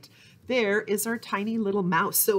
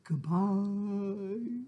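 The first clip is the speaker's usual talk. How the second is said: "Goodbye." dropped low and softer; 5 dB quieter than usual. Shown as a demonstration. Librarian sings the goodbye as low as she can. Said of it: "say goodbye as low as we can".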